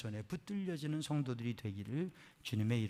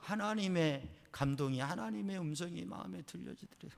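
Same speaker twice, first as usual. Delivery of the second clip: usual speaking voice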